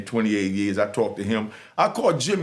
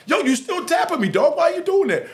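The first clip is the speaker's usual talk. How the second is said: annoyed New Yorker; 4 dB louder than usual. Retold or imitated. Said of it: "Dude be talking, be tapping".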